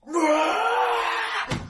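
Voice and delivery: high roaring battle cry; roaring grunting brute. Acted. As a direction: let out a savage roar. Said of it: "she goes".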